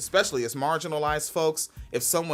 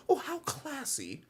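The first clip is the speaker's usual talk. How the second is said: softer, higher swooning gasp; mock impressed fan; 7 semitones higher, 9 dB quieter than usual. Right-wingers hearing a British accent, mock swooning. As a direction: breathe it like dazzled by class. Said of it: "They hear that British accent and they're like".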